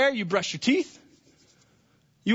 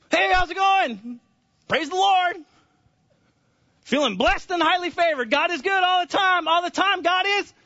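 high, exuberant church-speak; mock pious churchgoer; 11 semitones higher, 5 dB louder than usual. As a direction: parody an over-cheerful believer's greeting. Mimics pious church greeting.

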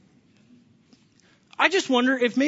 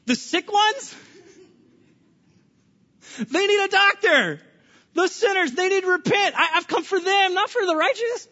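higher, amused, lightly laughing reply; Jesus replying; 9 semitones higher than usual. Retold or imitated. Jesus answering the Pharisees, amused high reply. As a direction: gently turn their words back on them. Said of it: "And Jesus goes".

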